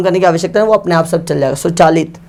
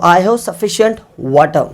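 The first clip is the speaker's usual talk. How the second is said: slow dictation; much slower than usual. Shown as a demonstration. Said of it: "जैसे मैंने कहा".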